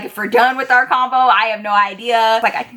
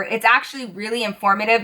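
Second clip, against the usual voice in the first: ordinary talk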